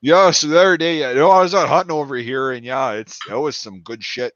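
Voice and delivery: broad imitated rural northern talk; mock northern country accent. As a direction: play a rural northerner telling a hunting story. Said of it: "they have a northern".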